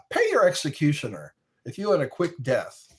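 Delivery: normal talking voice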